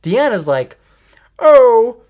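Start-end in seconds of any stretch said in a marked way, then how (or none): 1.4-2.0 put-on high, loud accented "oh"; accento di Diana. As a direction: exclaim it loudly in Diana's accent. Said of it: "this is her accent".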